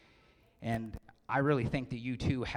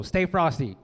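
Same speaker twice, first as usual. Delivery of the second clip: louder cue for the crowd to answer; loud call; 5 semitones higher, 8 dB louder than usual. Shown as a demonstration. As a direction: call it out to the room.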